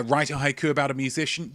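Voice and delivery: usual speaking voice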